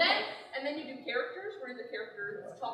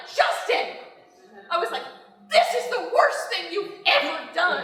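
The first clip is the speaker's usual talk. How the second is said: louder scolding call; mock exasperated yell; 2 semitones higher, 10 dB louder than usual. Wife retelling yelling at husband, louder. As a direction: shout at him in fed-up disbelief.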